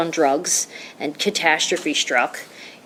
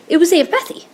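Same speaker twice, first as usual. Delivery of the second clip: much higher, stunned "It was Aunt Bethy"; astonished reveal; 11 semitones higher, 4 dB louder than usual. Real, not acted. Revealing the stranger in the road was the aunt, astonished. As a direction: burst out with the surprise.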